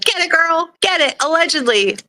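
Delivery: high excited cheer, hyped for a friend; cheering her on. Retold or imitated. Cheering on a friend in court, high and excited. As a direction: squeal it like a hype girl. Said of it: "I was like".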